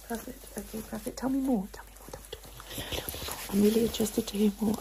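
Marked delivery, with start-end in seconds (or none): 1.7-2.1 very soft, short whisper; coaxing whisper. Pretend. Doctor urging patient to say more, whispered. 2.1-3.4 slow, repetitive breathy whisper; lingering whisper. Pretend. Doctor urging patient to say more, slow whisper.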